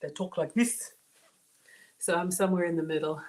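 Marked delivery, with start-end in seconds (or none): 0.0-0.9 a clear African accent in a different voice. Shown as a demonstration. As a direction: switch into an African parent's accent. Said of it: "having African parents".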